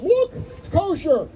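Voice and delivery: high, exasperated insistence; the chief rabbi showing the lifted tree, high and exasperated; chief rabbi insisting. Retold or imitated. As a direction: point at the proof, almost pleading. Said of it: "The chief rabbi goes".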